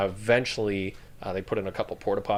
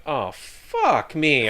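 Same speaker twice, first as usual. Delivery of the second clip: dismayed groan; 5 semitones higher, 4 dB louder, much slower than usual. Retold or imitated. Waking from wet dream, retold.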